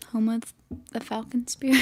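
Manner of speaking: unvoiced, hushed aside; whisper. Host jokes about Texas to co-hosts, whispering. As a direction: breathe it like a secret slogan.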